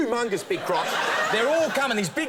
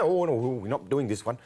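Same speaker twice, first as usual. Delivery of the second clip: mimicked low-pitched refusal; acted refusal; 7 semitones lower than usual. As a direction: lower voice for the reply. Acting snake handler's refusal.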